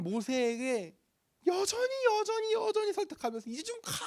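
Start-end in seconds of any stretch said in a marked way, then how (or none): 1.5-3.9 pleading persuasion. Acted. God still persuading Moses.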